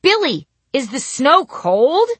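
high, slow, loud question; loud calling. Retold or imitated. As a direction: call across the audience. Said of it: "my grandma asked".

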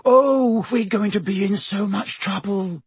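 high, panicked wail; frightened. A narrator's reading. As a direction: dread the consequences. Hermione frightened.

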